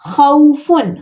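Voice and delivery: short glide on खौ; leen glide. Shown as a demonstration. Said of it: "लीन". (खौफुन, लीन आधा)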